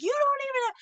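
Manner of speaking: very high squeaky protest; mock heckling. Parents interrupting the doctor's talk, mock heckling. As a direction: cut in as an outraged parent, hammed up. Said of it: "these parents are pretty much heckling this guy".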